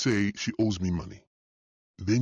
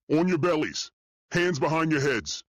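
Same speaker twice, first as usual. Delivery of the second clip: shouted orders; 8 semitones higher than usual. Acted. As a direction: shout over the ringing in your ears. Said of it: "I shouted over the ringing".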